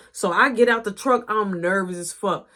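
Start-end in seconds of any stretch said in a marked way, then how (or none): none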